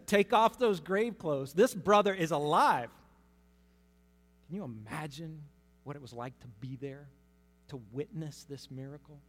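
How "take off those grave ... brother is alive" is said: loud command. Acted. Jesus commands the crowd.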